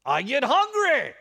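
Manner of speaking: hungry leader's sudden roar; booming punchline. Acted. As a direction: blow it up into a big comic shout. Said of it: "he grumbled through his accent".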